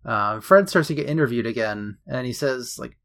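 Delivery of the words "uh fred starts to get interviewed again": usual speaking voice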